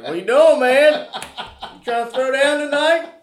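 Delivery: loud, high excited buddy voice calling out; mock the friend's eager party call; imitating buddy. Man imitating his friend's loud invite to party.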